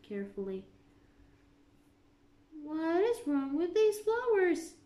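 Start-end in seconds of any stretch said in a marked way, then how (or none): 2.6-4.8 a bit higher, suspicious question; lightly voiced queen. A narrator's reading. Queen questions the gardeners, suspicious. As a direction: eye the flowers as the Queen. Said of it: "she asked".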